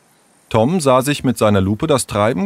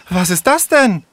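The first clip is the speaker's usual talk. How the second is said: out of breath; 10 semitones higher, 3 dB louder than usual. Acted. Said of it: "japste sie außer Atem".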